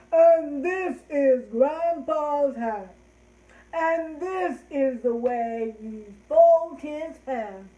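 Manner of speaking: big, loud, drawn-out grandpa; loud, very slow deep-voiced rhyme. Shown as a demonstration. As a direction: boom it out slowly as Grandpa. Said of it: "let's do Grandpa in our deep voices".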